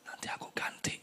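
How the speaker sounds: hushed gentle whisper; God whispering reassurance to the believer; soft reassurance. Retold or imitated. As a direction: promise it softly. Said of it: "Tuhan bilang".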